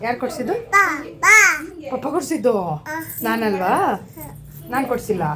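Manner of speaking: put-on high sing-song coaxing voice to a baby; baby talk. Mother coaxing her baby.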